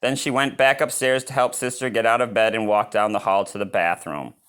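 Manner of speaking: plain reading voice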